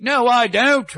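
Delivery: high put-on foreign accent denying it; [name]'s fake accent. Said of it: "He changes his accent".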